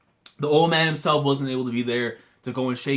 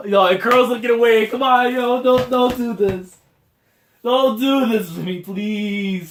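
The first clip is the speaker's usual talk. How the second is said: high, loud, stretched-out begging; desperate pleading; 9 semitones higher, 7 dB louder than usual. Real, not acted. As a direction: beg loudly, on the edge of losing it. Begs author not to make Kuro cry.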